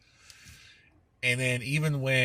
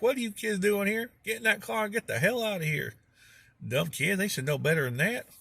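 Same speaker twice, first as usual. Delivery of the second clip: put-on grumpy old man voice; 10 semitones higher than usual. Stu yelling at kids.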